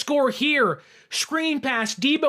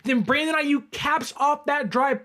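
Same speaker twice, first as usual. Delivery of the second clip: usual speaking voice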